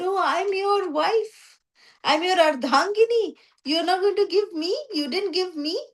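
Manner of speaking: high, plaintive complaint of a slighted wife; hurt wife. Retold or imitated. Wife Parvati complains, left out.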